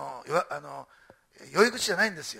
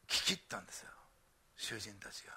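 hushed, breathy whisper; whispered reveal to the congregation; 11 semitones lower, 14 dB quieter than usual. Real, not acted. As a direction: drop to a whisper to draw them in. Hushed emphasis in sermon.

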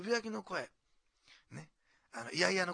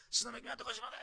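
higher-pitched thankful praise; imitated praise singers; 8 semitones higher, faster than usual. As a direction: give a light imitation of grateful praise. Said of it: "本当に感謝をして賛美歌を歌えた". (感謝の賛美をまねた高めの声)

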